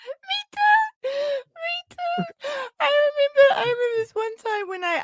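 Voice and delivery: high-pitched, helpless laughter through the words; laughing hard. Real, not acted. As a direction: squeak it out while cracking up. Laughing hard at friend's story.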